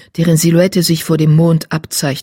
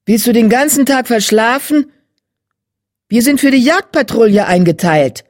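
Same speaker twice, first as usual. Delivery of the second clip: annoyed; 6 semitones higher, 4 dB louder than usual. Acted. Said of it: "ärgerlich".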